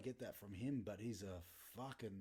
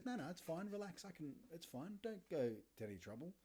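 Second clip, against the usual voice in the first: higher, fussing mock-polite protest; polite insisting guest; 6 semitones higher than usual. Matthias begging the dad not to bother, high and polite. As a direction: wave it off in a raised polite voice.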